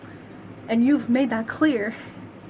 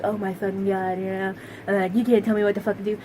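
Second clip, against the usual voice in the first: mocking caricature; 3 semitones lower, faster than usual. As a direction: lower mock voice of an entitled man. Mocking a male anti-abortion argument.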